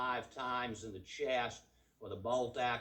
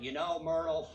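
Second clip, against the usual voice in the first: guest telling his wife he'd expected it; knowing country husband; 5 semitones higher than usual. Pretend. Hotel guest voiced to Myrtle.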